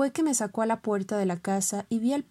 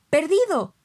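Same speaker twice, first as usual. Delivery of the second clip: even louder, high desperate call of the name; louder shouted name; 8 semitones higher, 8 dB louder than usual. Acted. Master yells again at his dog, louder shout. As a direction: yell the name with full force. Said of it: "me gritó mi amo".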